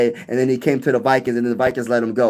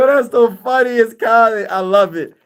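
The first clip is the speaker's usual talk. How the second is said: still laughing; 10 semitones higher, 5 dB louder, slower than usual. Real, not acted.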